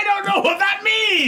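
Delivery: squeaky, slow, loud confused yell; high mock shout. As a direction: shriek it in slow disbelief. Mocking a confusing catchphrase.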